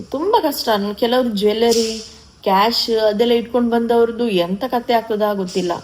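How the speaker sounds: usual speaking voice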